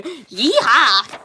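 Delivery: a playful cowboy whoop; light hammed-up yeehaw; mock cheer. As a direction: whoop it out for fun. Cheering in the comic.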